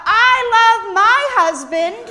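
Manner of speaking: high, loud joyful shout; yelled out. Retold or imitated. Wife shouting her love for her husband mid-service, loud joyful shout. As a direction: belt it out across the church. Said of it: "she yells out".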